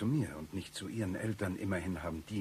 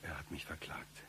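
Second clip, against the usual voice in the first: whispered admission; 2 semitones lower, 12 dB quieter than usual. Acted. Embarrassed confession as aside.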